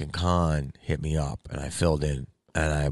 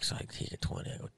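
ordinary delivery

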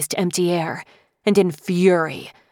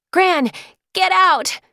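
much higher, louder desperate yell; 14 semitones higher, 4 dB louder, slower than usual. Acted. Lily warning Gran away from the dragon, high loud yell. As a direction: scream the warning across the kitchen. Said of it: "Lily yelled".

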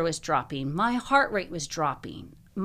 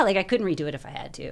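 ordinary talk

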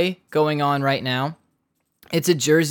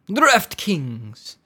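DraftKings said higher in a put-on fancy accent; 5 semitones higher than usual. Shown as a demonstration. Podcast host naming his sponsor, in a fancy accent. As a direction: give DraftKings a grand, fancy-accent flourish. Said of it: "I wanna say that, like, in a fancy accent every time".

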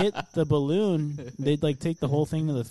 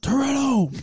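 high, loud mock yell; reenacted shout, pitched way up; retold yell; 10 semitones higher, 5 dB louder, slower than usual. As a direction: yell it out as a sudden outburst. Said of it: "I just yelled out".

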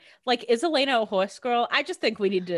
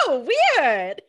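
retold reaction, higher and louder; mock surprise; 4 semitones higher, 6 dB louder than usual. Podcaster acting out a reaction to the show, mock surprise. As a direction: pitch up a playfully surprised reaction.

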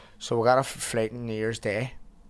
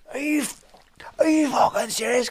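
high, loud mock outrage; imitating a friend's furious yell; retold furious shout; 14 semitones higher, 5 dB louder than usual. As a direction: shout it like disbelief at a missed flight. Retold angry outburst at airport.

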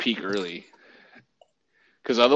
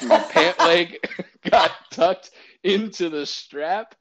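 slower, laughing through words; 6 semitones higher, 7 dB louder, slower than usual. Real, not acted. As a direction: chuckle while telling it. Laughing at own mishap.

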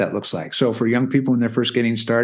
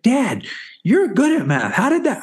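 higher-pitched mock exasperation; hammed-up child fed up with dad; frustrated little daughter; 11 semitones higher than usual. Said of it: "she would get so frustrated".

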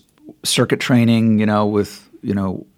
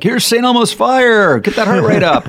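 hyped-up spin instructor; 12 semitones higher, 4 dB louder than usual. Retold or imitated. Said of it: "Rob Lowe callbacks".

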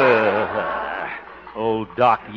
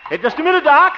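high, loud hail to someone leaving; calling out; 8 dB louder than usual. Acted. Harold hails the horse doctor in his buggy, calling out. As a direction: shout to stop him in his tracks.

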